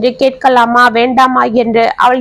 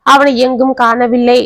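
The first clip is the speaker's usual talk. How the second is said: plain reading voice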